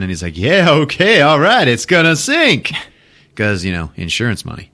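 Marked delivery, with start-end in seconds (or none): 0.4-2.7 higher, louder mock elation; gleeful character. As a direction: crow it with put-on delight. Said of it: "then he starts laughing".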